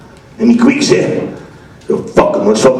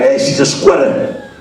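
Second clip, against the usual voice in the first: put-on old-school Italian accent; 2 semitones higher than usual. Landlord complains to tenant about the girlfriend, old-school Italian accent. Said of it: "It's old school Italian".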